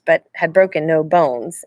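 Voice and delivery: ordinary delivery, nothing marked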